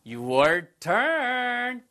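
high, held call to the learners; drawn-out cue. Real, not acted. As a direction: sing out the cue, stretching the last word. Cueing learners to answer.

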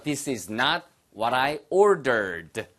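very slow, every word separate; slow unconTRACTed demo; slow full form. Shown as a demonstration. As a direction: spell out "is not" slowly. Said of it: "아까 나왔잖아요".

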